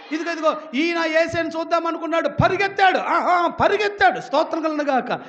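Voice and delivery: loud, high, rousing sermon shout; preaching at full force. Real, not acted. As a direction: whip the congregation up with long, shouted calls. (గొంతెత్తి ఉత్సాహంగా ప్రసంగం)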